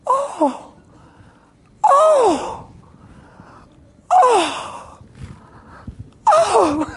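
very high, loud repeated "oh"; squealed "oh"s of astonishment; imitated amazed gasps. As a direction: act out her shocked, tearful cries. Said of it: "She went".

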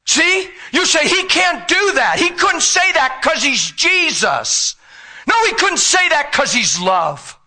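preaching at full force; fiery preaching. Real, not acted. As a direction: drive the point home to the crowd.

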